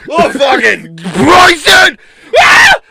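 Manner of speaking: high, slow, loud screech; hammed-up frustrated shriek; mock scream. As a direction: wail the name in fake agony.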